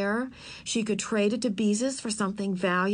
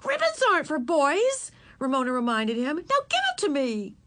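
much higher bossy reminder; little girl bossing, much higher; 7 semitones higher than usual. Acted. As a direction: put him in his place. Girl argues with boy.

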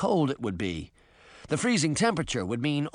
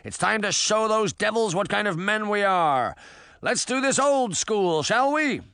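high, rousing captain's call; rallying cry; 6 semitones higher than usual. A narrator's reading. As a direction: fire up the crew for battle. Captain rallies crew under fire.

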